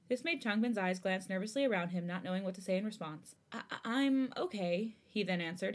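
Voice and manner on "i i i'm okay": halting, nervous stammer; shaky voice. A narrator's reading. Changbin answering Jisung after a silence, shaky stammer. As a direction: stumble slowly into a nervous answer. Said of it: "his voice shaking".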